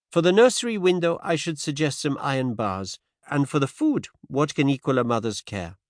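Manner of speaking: a touch of Poirot's polite scepticism; Poirot, gently dismissive. A narrator's reading.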